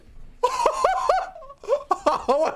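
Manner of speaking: high hysterical laugh; real giddy shriek-laugh. Real, not acted. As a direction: cackle with relief. Surviving a close fight.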